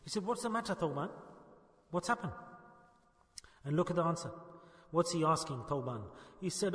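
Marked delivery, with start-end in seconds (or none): 0.3-2.3 soft, caring question; gentle concern. Acted. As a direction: ask tenderly, like a kind teacher. Prophet voiced asking gently again.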